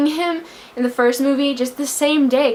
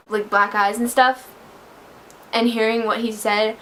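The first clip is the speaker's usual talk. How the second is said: ordinary talk, nothing marked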